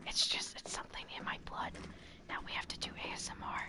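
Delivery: soft ASMR-style whisper. Shown as a demonstration. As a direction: whisper close to the mic. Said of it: "I can't stop whispering now".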